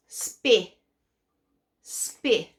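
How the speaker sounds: "Spi" with the short i of spinach; short I blend. Shown as a demonstration. Teacher to kids, modelling the short i in "Spi". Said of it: "Like spinach".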